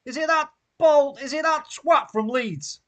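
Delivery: high, slow, loud outrage; angry rant. Real, not acted. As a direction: spit it out in disgust. Fan ranting about the referee.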